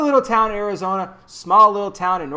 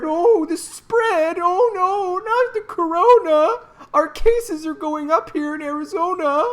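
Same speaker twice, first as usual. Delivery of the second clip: much higher, whiny mock panic; mocking scared voice; 11 semitones higher, 4 dB louder, slower than usual. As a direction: parody a frightened person, slow and shrill. Mocking scared mask supporters.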